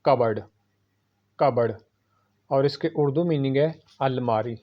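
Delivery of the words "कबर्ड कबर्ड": कबर्ड with a silent p, ka-berd; कबर्ड as kub-erd; correct pronunciation. Shown as a demonstration. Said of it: "इसकी सही pronunciation है".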